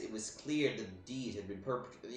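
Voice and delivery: scornful, punchy reading of a bitter lancer's tale; wronged lancer villain. Acted. Lancer sneers at the player about false friends, scornful and declamatory. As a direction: declaim it like a disgraced lancer nursing betrayal. Voices game villain's dialogue.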